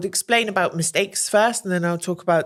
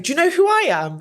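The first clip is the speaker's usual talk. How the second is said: higher mock-grand joking question; 10 semitones higher, 4 dB louder, faster than usual. The Prime Minister teasing, a higher mock-grand question. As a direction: tease her playfully. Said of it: "Like, joking".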